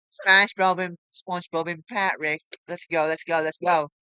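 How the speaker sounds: high loud chanting cheer; excited cheering. Real, not acted. Kid cheering SpongeBob and Patrick, loud and excited. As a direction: chant it like a fan.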